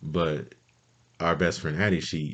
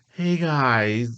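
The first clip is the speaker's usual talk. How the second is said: high, louder mock phone greeting; sheepish friend; 9 semitones higher, 5 dB louder than usual. Missing friend finally picking up the call, sheepish. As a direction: imitate her greeting in a raised pitch. Addie answers after vanishing.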